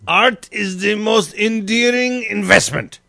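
put-on slow, high German accent. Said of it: "all in a German voice".

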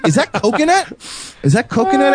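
high-pitched, over-curious question; mock-fascinated weirdo; creepy sniffer act. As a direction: put on a high, nosy voice, sniffing at someone's hair. Acting a creepy hair sniffer.

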